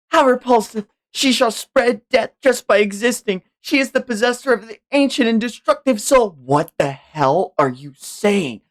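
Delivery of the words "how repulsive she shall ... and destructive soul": high, scornful pronouncement; high voiced contemptuous noble; disgusted accuser. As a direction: sneer at the cursed baby. Voicing accuser character.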